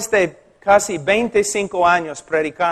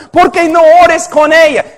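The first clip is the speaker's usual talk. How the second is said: much higher and louder mock protest; objecting listener; 9 semitones higher, 8 dB louder than usual. A listener objecting to the pastor, high and loud. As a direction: voice the audience's challenge.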